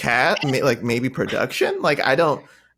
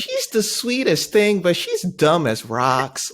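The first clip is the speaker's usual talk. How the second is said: put-on Southern accent; 5 semitones higher, slower than usual. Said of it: "I apologize for my accent".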